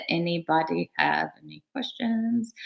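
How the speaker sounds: soft whispered question. Real, not acted. Asking the viewers for questions in a whisper.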